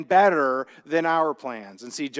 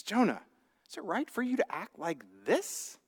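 gentle question; 8 dB quieter than usual. Retold or imitated. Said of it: "making him stop and think".

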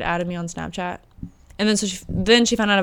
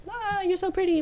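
high, airy gush of a compliment; mock-sweet high gush; gushing sister; 11 semitones higher, 5 dB quieter than usual. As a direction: coo it like an over-eager little sister. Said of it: "Kinda reminds me of my sister Callie".

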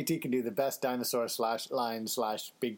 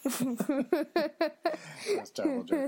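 laughing. Real, not acted. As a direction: laugh at your own joke. Laughing at own joke.

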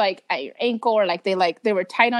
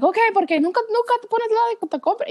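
mock angry mom; 11 semitones higher, faster than usual. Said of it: "getting mad at me".

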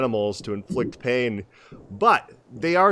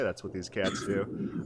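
normal talking voice

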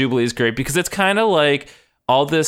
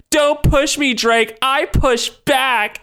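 higher-pitched, hammed-up confrontation; mock angry warning; 10 semitones higher, much slower than usual. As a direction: imitate a worked-up standoff, dragging the end. Acted quarrel, Cyclops warns Drake.

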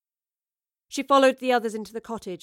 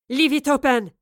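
loud, raised-pitch scream of command; urgent screamed order; 4 semitones higher, 8 dB louder than usual. A narrator's reading. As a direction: scream it across the room. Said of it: "she screamed at Evie".

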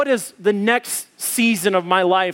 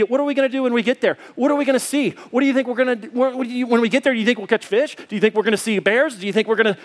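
rapid-fire imitation of an eager kid; quick, pestering mock-child chatter; excited child's questioning; 3 semitones higher, much faster than usual. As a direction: tumble question after question without a break. Daughter's endless excited questions acted out.

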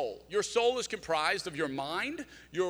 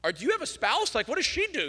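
incredulous higher question; 9 semitones higher, 4 dB louder than usual. Retold or imitated. Quizzing friend about his mornings.

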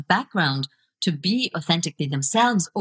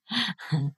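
short, soft laugh; amused laugh; 7 dB quieter than usual. Real, not acted. Guest amused by question on barriers to video, soft laugh. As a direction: laugh softly to yourself before answering. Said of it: "I'm laughing because".

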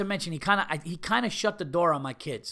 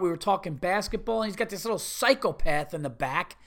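normal talking voice

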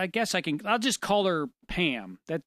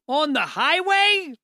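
louder, high, slow disbelief; incredulous; 9 semitones higher, 6 dB louder, slower than usual. Real, not acted. Reacting to a driver letting go on the highway, incredulous. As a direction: stretch the outrage out.

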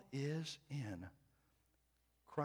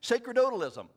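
Sacerdotalism said much higher and louder, every syllable spelled out; showing off a big word; 12 semitones higher, 13 dB louder than usual. Shown as a demonstration. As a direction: land Sacerdotalism like a proud announcement. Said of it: "I hope I got the emphasis on the right syllable".

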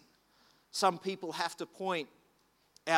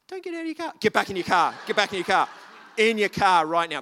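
loud commanding yell; full-voiced order to get back in the car; retold shout; 9 dB louder, faster than usual. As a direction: bark at the boys to stay in the car. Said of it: "I'm like".

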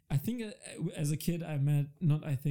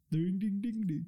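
put-on Indian accent; 4 semitones higher than usual. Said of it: "You made it in an Indian accent".

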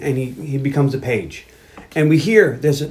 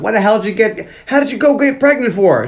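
litigio urlato, recitato; 6 semitones higher than usual. Retold or imitated. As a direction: act out an indignant yell, pitched way up. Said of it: "she's yelling at him".

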